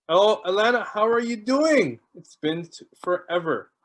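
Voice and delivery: ordinary delivery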